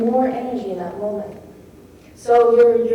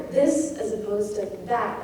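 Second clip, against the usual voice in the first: this said louder and that said light; 4 dB quieter than usual. Shown as a demonstration. Lecturer showing that the stressed word is louder. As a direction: punch the word this, let that fall away. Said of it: "it's usually louder".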